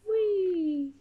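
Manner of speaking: high, gleeful little whee; playful whee. Acted. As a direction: join in the fun of the swing. Character swinging in picture.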